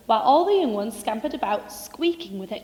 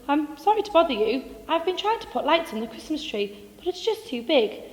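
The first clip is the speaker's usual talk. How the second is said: small, pleading and higher; timid mole voice; 6 semitones higher, 3 dB louder than usual. Acted. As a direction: ask nervously, rising to a plea.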